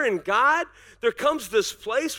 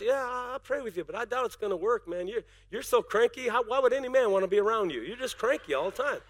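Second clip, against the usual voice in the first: sour doubting pastor; 3 semitones lower, 7 dB quieter than usual. Pretend. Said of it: "mock pastor".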